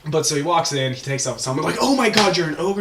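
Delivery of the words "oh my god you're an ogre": high, loud mock alarm; shocked outburst. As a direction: cry out in hammed-up shock. Acting astronauts discovering the ogre.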